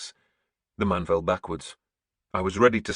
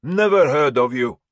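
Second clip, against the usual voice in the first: loud, higher snarling voice; snarled; 7 semitones higher, 9 dB louder than usual. A narrator's reading. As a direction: spit it out with contempt. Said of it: "he snarled".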